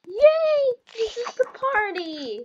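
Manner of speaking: very high, loud yay; loud shrieked yay of joy; ecstatic shout. Real, not acted. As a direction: scream it with delight. Excited at the party.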